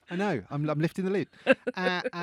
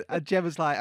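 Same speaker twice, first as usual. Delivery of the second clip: normal talking voice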